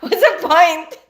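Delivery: loud, exasperated what's the point; a loud fed-up question; exasperated outburst. Real, not acted. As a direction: throw up your hands at it out loud. Exasperated over forgetting lists.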